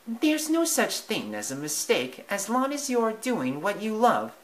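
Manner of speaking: high, kind mouse mother. Acted. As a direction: reassure her son gently. Mother voiced in story.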